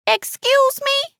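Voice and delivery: high, slow, incredulous question; indignant shock. A narrator's reading. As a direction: stretch it out in high-pitched disbelief. Alice shocked by the confession.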